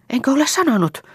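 high, startled question; surprised. A narrator's reading. Liisa surprised that her friends don't know her fiancé. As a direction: feign surprise at being asked.

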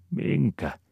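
very high, wondering one-word question; puzzled wondering. A narrator's reading. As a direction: ask in puzzled surprise. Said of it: "ihmettelee Aapeli".

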